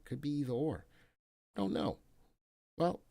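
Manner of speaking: ordinary talk, nothing marked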